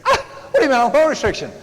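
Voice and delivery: put-on high, fast, indignant teenage whine; protesting teen. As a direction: whine back like a daughter who can't believe it. Teen daughter protesting punishment.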